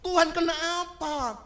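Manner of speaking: high, loud wailing plea; crying out to God. Retold or imitated. Grieving widow screaming at God, high and loud. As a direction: wail at God in grief. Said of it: "dia teriak-teriak sama Tuhan".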